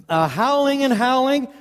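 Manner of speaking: ordinary talk, nothing marked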